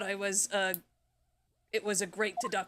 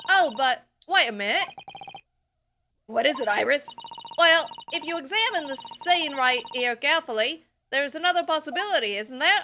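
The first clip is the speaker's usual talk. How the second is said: voiced game dialogue; 5 semitones higher, 4 dB louder than usual.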